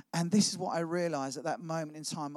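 ordinary talk, nothing marked

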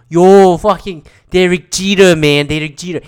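hammed-up loudmouth fan imitation; New York sports fan. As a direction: belt it like a mock sports radio caller. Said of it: "New York sports fan that was like".

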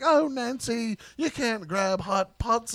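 a hammed-up prim scold; mock posh scolding. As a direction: drag out the telling-off in a silly voice. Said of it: "And he's like".

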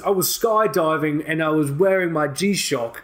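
put-on a loose, half-placed South African-ish accent for a joke story; Leonardo DiCaprio Blood Diamond accent. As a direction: tell the skydiving tale in a hammy Blood Diamond accent. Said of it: "my Leonardo DiCaprio Blood Diamond impression".

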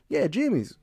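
put-on squeaky caricature of an old-timer asking for jimmies. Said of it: "And they're like".

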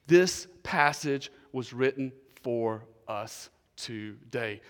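slow, spaced-out key point; slow and deliberate. Real, not acted. Preacher gives the congregation his first point, slow and deliberate. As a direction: lay it down word by word, unhurried.

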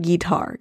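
"guitar" said as GEE-tar, first syllable stressed; country "guitar". Retold or imitated. Podcaster quoting [name]'s word, "guitar" as GEE-tar. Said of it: "He didn't say guitar. He said guitar".

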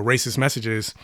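normal talking voice

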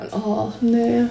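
usual speaking voice

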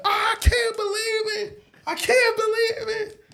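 high, excited squeal, laughing; screaming kids. Retold or imitated. Mimicking schoolkids at the surprise, high excited scream. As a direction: shriek it like a thrilled child. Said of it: "All the kids are screaming".

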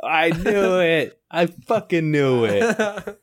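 slow, high hammed-up dread; mock dread. Friend mocks an Australian invasion with slow high dread. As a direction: drag it out in pretend horror.

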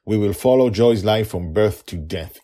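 ordinary delivery

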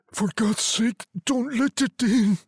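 high, fearful pleading cry; terrified old man. A narrator's reading. Old man begging his wife, shaking with fear. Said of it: "cried the old man, shaking with fear".

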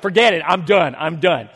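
loud exasperated "I'm done"; fed-up giving up. Retold or imitated. Husband telling family he's finished, fed up and loud. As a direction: throw up your hands, totally done.

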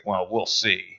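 low, quiet note to oneself; understated acted reservation; private aside. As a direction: say it under your breath, as if kept in the heart. Peter privately keeps his doubt.

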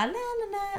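high, soft coy refusal; coy girl. Retold or imitated. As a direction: play the innocent girl. Härmar oskuldsfulla tjejer. (högt och blygt nej)

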